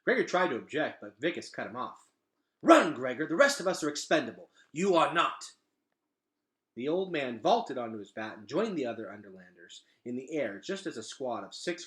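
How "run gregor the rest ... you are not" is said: loud, higher, rushing command; urgent shout. A narrator's reading. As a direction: shout him away to safety. Vicus urges Gregor to flee.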